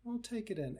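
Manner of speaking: soft, coaxing invitation; gentle guiding voice. Shown as a demonstration. Teacher guiding the hurt part to the yard, soft and coaxing. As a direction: lead them softly toward something nice.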